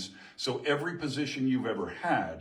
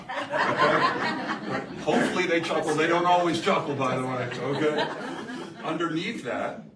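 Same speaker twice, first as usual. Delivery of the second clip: louder, laughing through the words; 4 semitones higher, 7 dB louder than usual. Real, not acted. Speaker laughing at his picture joke with the crowd. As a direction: chuckle at your own joke.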